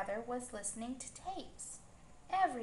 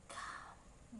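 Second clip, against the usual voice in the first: soft breathy whisper, no voice; the path whispering to the girl, come; 10 dB quieter than usual. A narrator's reading. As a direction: breathe the word like the path calling. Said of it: "whispered the path".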